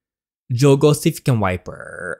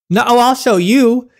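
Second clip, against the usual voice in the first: high, loud defiant outburst; defiant threat; 11 semitones higher, 7 dB louder than usual. Acted. Captive girl to vampire who calls it a bluff, defiant threat. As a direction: throw the dare back loudly.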